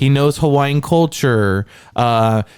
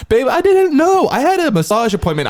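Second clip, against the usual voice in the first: whiny husband voice; 10 semitones higher than usual. Pretend. Mock husband excusing himself.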